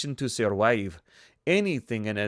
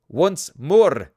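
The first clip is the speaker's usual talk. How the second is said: put-on Russian accent; 5 semitones higher, 10 dB louder than usual. Chekhov rallies in battle.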